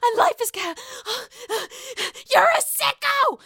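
high, loud mock Bethany shriek; imitating Bethany. Vlogger mimics Bethany calling Luann a sicko, high and loud. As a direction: shriek it out in disgust. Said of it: "if you were Bethany".

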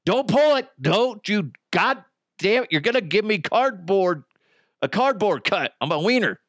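excited yelling. Acted. Acted partner warning.

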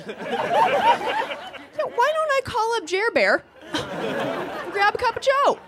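high sarcastic mock enthusiasm. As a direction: sell it with fake cheer. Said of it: "mocking mom's idea".

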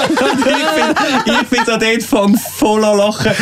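laughing through the words; high, breathless giggling talk. Real, not acted. Laughing while retelling the story. (lachend erzählt)

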